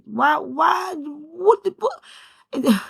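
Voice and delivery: high, loud disbelieving cry; half laughing, half crying in disbelief; emotional outburst. Real, not acted. Talking to her viewers, overcome, a real emotional cry.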